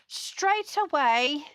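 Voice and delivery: slow, hammed-up British accent; bad British accent. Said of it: "That's my very bad British accent".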